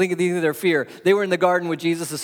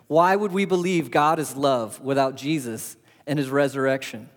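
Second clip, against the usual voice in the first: usual speaking voice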